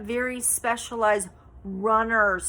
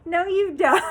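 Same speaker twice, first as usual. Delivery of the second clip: higher, laughing through the words; 9 semitones higher, 6 dB louder than usual. Retold or imitated. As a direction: crack up while repeating it. Husband quote, breaking into laughter.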